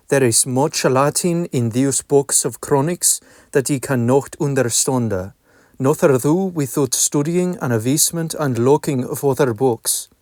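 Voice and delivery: reading in reconstructed Middle English pronunciation; old vowels, 'nations' as nasjɔnz, 'out' as ut. Shown as a demonstration. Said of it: "I shall now begin reading Dialogue Between a Lord and a Clerk".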